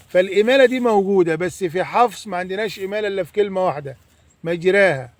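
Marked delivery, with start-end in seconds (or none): none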